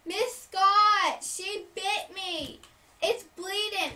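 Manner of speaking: put-on high, loud tattling kid; mock yell of a hurt kindergartner; imitated screaming. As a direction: wail it out to the teacher, drawn out. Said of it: "and so he screamed".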